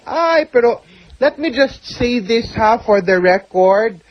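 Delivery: normal talking voice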